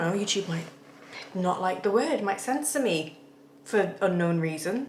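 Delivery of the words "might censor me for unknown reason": ordinary delivery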